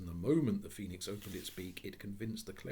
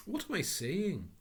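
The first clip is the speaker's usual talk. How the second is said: higher, slow, baffled; startled self-correction; 11 semitones higher, 6 dB louder than usual. Acted. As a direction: catch himself mid-sentence. Gentleman catches himself.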